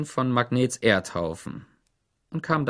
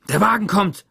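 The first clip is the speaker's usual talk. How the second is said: raised, higher warning call; narrated yell; 9 semitones higher, 5 dB louder than usual. A narrator's reading. Zickzack warns the boys the truck is coming, louder and higher. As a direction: bark out the warning. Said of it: "brüllte Zickzack".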